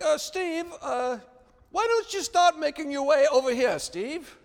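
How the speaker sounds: imitating a high, polite British instructor; lilting British call across the water, pitched high; British accent. Instructor calling a drifting boy back, in a British accent. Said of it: "he had kind of a British accent".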